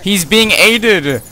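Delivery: slow, loud outburst of protest; outraged gamer yell; indignant shout. Real, not acted. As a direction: cry foul, drawing the words out loudly. Boss gets help mid-fight.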